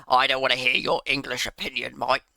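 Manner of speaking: put-on Australian voice for a quote; mock Australian accent. Streamer quoting his Scottish critic, mock Australian accent. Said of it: "Don't know why I gave you Australia".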